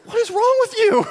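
high, loud disbelief; exasperated dad. Retold or imitated. Father calls after his running toddler, high loud disbelief. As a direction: throw up your hands at the toddler.

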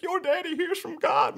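high-pitched imitation of an astonished man; amazed excitement. Retold amazed man addresses son.

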